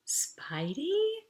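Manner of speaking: teasing whisper. Acted. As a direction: tease her with the nickname under your breath.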